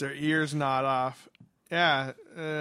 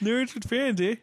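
much higher, Canadian eh tacked on; mock Canadian; 8 semitones higher than usual. Cohost imitating a Canadian listener, hammed-up Canadian accent. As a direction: play a chirpy Canadian listener. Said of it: "Little Canadian, eh?".

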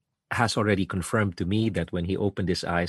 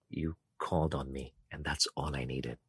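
soft, tender voice of Jesus calling his son; gentle Jesus; 5 semitones lower, 9 dB quieter than usual. Retold or imitated. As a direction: speak it softly, like comforting a child.

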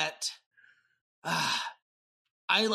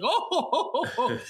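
high, laughing ho ho ho; Santa's merry laugh mid-tickle; jolly laugh; 7 semitones higher than usual. Retold or imitated. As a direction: play a jolly Santa chuckle. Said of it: "It's, like, real jolly".

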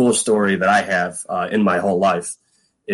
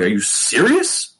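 slow, higher-pitched incredulous question; retold disbelief; 4 semitones higher, slower than usual. Player questioning his roommate's news, incredulous. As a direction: stretch it out in amazement. Retelling shocked news from teammate.

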